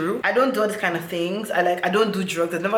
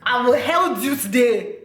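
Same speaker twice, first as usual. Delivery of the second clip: gloating devil; 3 semitones higher, slower than usual. Retold or imitated. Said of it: "That was where the devil said".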